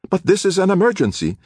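high, loud exasperated protest. Acted. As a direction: push back hard. Nordquist exasperated at bureaucrat.

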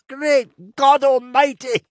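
high, strained wheeze, drawn out slowly; wheezing. Acted. Drinker with tears in his eyes after coughing, wheezing. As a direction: choke it out loudly after a coughing fit. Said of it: "he wheezed".

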